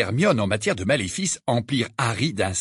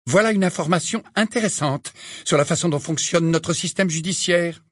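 higher, dry stern teacher; McGonagall's voice; 6 semitones higher than usual. Acted. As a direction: tart remark from a strict teacher.

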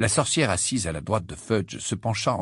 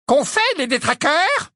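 put-on old woman voice; 5 dB louder than usual. A narrator's reading. Mrs Figg questioned, high voice.

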